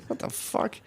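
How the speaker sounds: breathless whisper; stunned whisper; whispered what the fuck. Retold or imitated. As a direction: mouth it in disbelief, voiceless. Quoting his own reaction.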